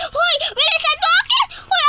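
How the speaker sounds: high loud hurry-up shout. Real, not acted. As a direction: yell at them to hurry. Calling friend to hurry.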